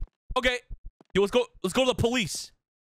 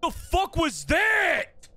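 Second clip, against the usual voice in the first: high, drawn-out disbelief; slow, high outburst of alarm; startled shout; 9 semitones higher, 3 dB louder, much slower than usual. Real, not acted. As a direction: yell it in shock, stretching the last word. Startled by noise in cave.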